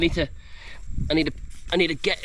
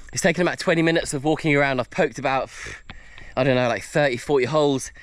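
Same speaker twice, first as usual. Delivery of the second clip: ordinary talk, nothing marked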